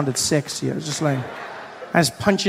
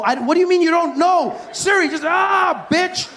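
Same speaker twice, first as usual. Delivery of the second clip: yelling at phone; slower than usual. Retold or imitated. Yelling at Siri.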